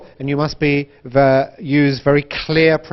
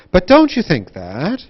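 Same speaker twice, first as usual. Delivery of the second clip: "don't" raised, dipping then rising on "that"; wide intonation; 3 semitones higher than usual. Shown as a demonstration. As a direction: exaggerate the tune after "don't". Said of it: "think about connected speech and intonation".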